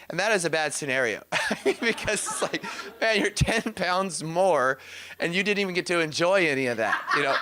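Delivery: amused, talking through real laughter; laughing. Real, not acted. Laughs while telling a funny story.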